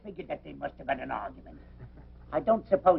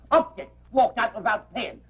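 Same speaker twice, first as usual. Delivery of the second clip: sharply higher and louder, then slow; indignant outburst; 6 semitones higher, 3 dB louder than usual. Acted. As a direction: spit out the grievance, then drag it. Publican rages about unpaid bill.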